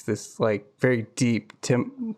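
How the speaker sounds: ordinary talk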